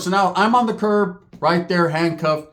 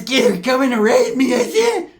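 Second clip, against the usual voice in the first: higher, louder panicked voice; mock panicked Pepe; 6 semitones higher, 3 dB louder than usual. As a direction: blurt it like a cornered man.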